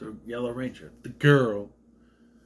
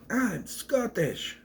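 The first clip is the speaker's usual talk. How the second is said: mock Scottish; 6 semitones higher, 4 dB quieter, much slower than usual. Scottish character repeating his one answer, high slow mock Scottish accent. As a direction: draw it out in a put-on Scottish voice. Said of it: "who's just randomly Scottish".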